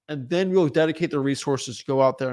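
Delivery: ordinary delivery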